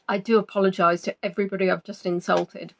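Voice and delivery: normal talking voice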